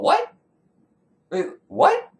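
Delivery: high, incredulous what; baffled reaction. Acted. Storyteller reacting to the sacrifice plan, baffled. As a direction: balk at the absurd detail, pitch jumping up.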